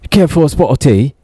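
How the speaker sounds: lightly hammed British hero asking for tea; playful fake British accent; bad British Batman. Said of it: "That's not very intimidating".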